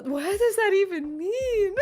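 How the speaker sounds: high, drawn-out laughing bewilderment; baffled. Real, not acted. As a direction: stretch it out high, totally confused. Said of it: "I'm so confused".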